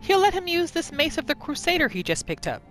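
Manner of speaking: normal talking voice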